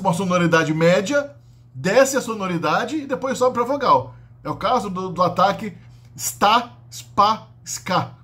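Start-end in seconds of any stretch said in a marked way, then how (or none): 6.2-8.1 sta, spa, ska said as bare syllables; syllable demo. Shown as a demonstration. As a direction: say sta spa ska without a vowel before. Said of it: "Quando a sílaba começa com S".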